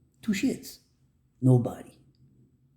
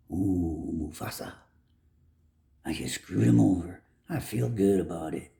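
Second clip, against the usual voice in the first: gloating villain; 5 semitones lower than usual. Retold or imitated. Voicing people who enjoy cruelty.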